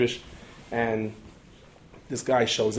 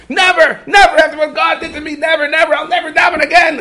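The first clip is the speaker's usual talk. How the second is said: much higher, much louder, bellowing refusal; furious shout; 12 dB louder than usual. Retold or imitated. Refugee shouting at the Rav that he won't pray, furious. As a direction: yell it out in bitter rage, never again.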